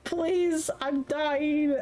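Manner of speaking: high breathy whimpering plea; retelling a tearful call to her partner; mock weeping. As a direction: beg like a sobbing child. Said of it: "weeping like a child".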